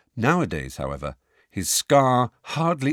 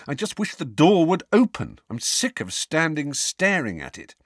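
frustrated; 6 semitones higher than usual. A narrator's reading. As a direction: let the frustration spill over. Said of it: "rubbed his forehead angrily".

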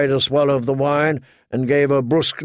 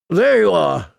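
put-on gruff, higher commanding old lord; gruff old man; 5 semitones higher, 3 dB louder than usual. A narrator's reading. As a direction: bark it like a grizzled commander. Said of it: "the old man said gruffly".

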